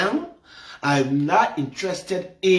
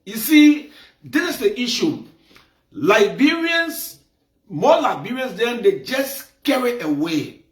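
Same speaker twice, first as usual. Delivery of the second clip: heated rant; 7 semitones higher than usual. Real, not acted. To viewers about Liberians, heated raised scolding. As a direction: scold the whole audience, voice climbing.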